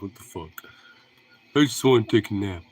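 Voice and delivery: low, grumpy dog voice; a hint of a disgruntled dog; voicing the dog. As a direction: speak for the sleepy dog. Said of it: "He looks disgruntled. He's just like".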